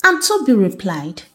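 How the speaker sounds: ordinary delivery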